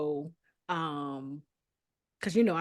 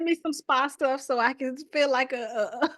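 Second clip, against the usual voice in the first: high, loud and giggly; raised voice tickled by its own joke; laughing mock request; 8 semitones higher, 7 dB louder than usual. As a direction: playfully put in a request, breaking into laughs. Joking request from deployment, laughing.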